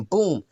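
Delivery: sudden punchy "boom"; imitated bang. Preacher sounding out a door kicked in, punchy imitated bang. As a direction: mimic a door being kicked in. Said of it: "you just hear gunshots going off".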